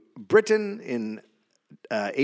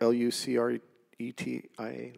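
spelled out letter by letter; 3 semitones lower, 9 dB quieter than usual. Shown as a demonstration. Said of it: "I don't know how to say this name".

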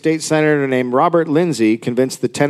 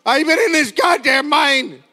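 put-on old miner voice; 11 semitones higher than usual. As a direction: shout it like a miner stuck for ages. Old trapped miner complains.

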